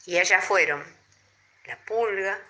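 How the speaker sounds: ordinary delivery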